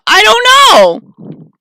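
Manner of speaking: high, very slow, loud screech; screeching Gollum creature. Acted. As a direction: shriek like Gollum. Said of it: "Are you Gollum?".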